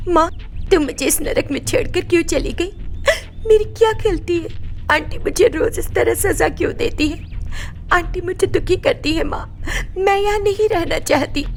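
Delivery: high, tearful sobbing plea; crying. Acted. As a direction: weep to your dead mother's picture. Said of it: "रो पड़ी". (रोते हुए, ऊँची काँपती आवाज़ में)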